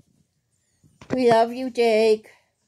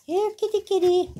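high sing-song call; little girl calling her cat; calling the kitty; 10 semitones higher, faster than usual. A narrator's reading. Girl calls the cat again.

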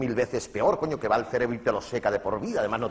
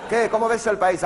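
loud, higher, brash reporter voice; mock interviewer; 10 semitones higher, 7 dB louder than usual. A reporter shouting a question at a footballer. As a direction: shove the mic at him and bark the question.

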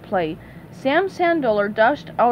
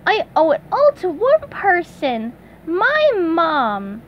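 modest; 6 semitones higher, 3 dB louder, slower than usual. Acted. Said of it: "said SpongeBob modestly".

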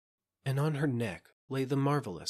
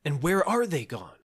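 higher, louder urgent question; cried out; 6 semitones higher, 6 dB louder than usual. A narrator's reading. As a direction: cry it out, demanding an answer. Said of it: "cried Gervais".

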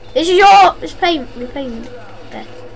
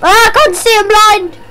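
loud, higher, alarmed cry; panicked yell; 5 semitones higher, 6 dB louder than usual. Real, not acted. Player blinded mid-fight, yelling in alarm. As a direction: shout in a sudden panic.

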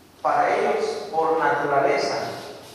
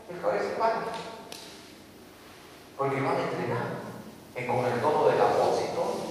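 ordinary talk

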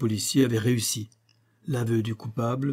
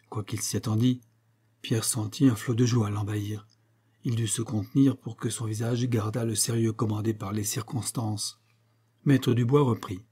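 plain reading voice

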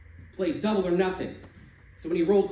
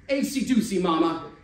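loud, high gleeful yell; Louis yelling over the craps; 6 semitones higher, 8 dB louder than usual. Acted. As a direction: crow it across the game. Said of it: "Louis started yelling".

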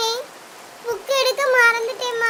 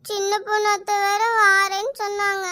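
usual speaking voice

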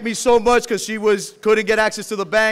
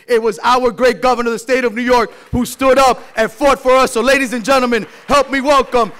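building to a loud, higher call; rousing introduction; 4 semitones higher, 6 dB louder than usual. Real, not acted. Speaker hyping the crowd for the governor, rising loud call. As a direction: whip the crowd up as you bring him on.

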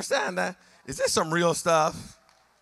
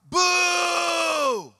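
high mocking boo from the crowd; scornful crowd at the cross booing Jesus; 8 semitones higher, 3 dB louder than usual. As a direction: boo him like a jeering mob. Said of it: "also means to boo".

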